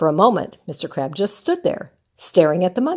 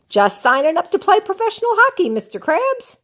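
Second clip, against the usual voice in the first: much higher, bright and proud; beaming; 10 semitones higher than usual. Acted. SpongeBob telling Mister Krabs his news, beaming. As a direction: announce it with a huge grin. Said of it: "SpongeBob beamed".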